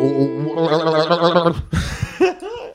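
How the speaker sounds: long cackle after a silly bit; laugh. Real, not acted. Host cracks up at the pickle riff, long laugh. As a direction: laugh at your own nonsense.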